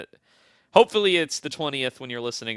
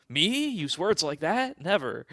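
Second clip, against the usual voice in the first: higher-pitched feigned disbelief; mock innocence; 6 semitones higher, slower than usual. Player answers a warning about swearing, mock innocent. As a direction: play the wrongly accused innocent, drawn out. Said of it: "And he was like".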